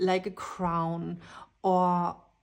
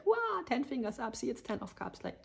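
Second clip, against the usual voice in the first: ordinary talk, nothing marked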